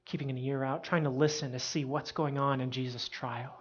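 ordinary talk

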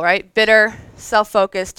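usual speaking voice

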